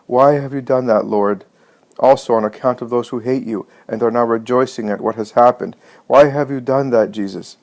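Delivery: plain reading voice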